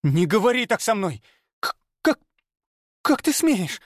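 put-on very high, indignant girl's voice; voiced girl stammering with outrage; outraged girl. A narrator's reading. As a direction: stammer in fury. Viola outraged at hero.

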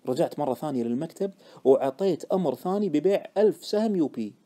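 plain reading voice

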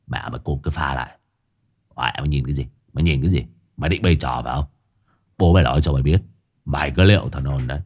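low, rough curses at the dog; gruff drunk scolding dog. Acted. Drunk Hải Nghẹo yells at dog.

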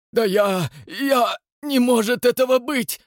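high, loud stammering disbelief; shocked. A narrator's reading. Rastopchin clutching his heart, shocked. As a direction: stumble over words in shock.

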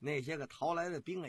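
ordinary delivery

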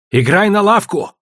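high, urgent demand; challenging shout. A narrator's reading. Challenge to stake the shop.

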